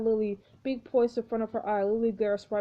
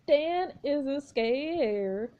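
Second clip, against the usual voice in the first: ordinary talk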